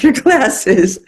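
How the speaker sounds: amused retold excuse with a laugh breaking in; laughing mock refusal. God absurdly refusing to heal over glasses, laughing mock refusal.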